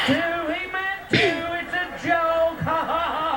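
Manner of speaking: high, drawn-out panicked yelp; mock panic. Father and son at the doctor's, acted high-pitched panic. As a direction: plead shrilly over a forced laugh. Frantic reply as son flips out.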